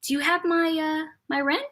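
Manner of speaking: lightly voiced polite landlady; coy grandmother. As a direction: imitate grandma gently angling for money. Grandmother coyly asks tenant for rent.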